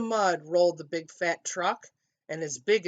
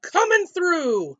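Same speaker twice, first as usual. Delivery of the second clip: high, loud, drawn-out call; yelling; 11 semitones higher, 8 dB louder, slower than usual. A narrator's reading. Dump truck barging through, yelled call. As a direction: holler it slowly to clear the road. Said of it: "yelled a dump truck".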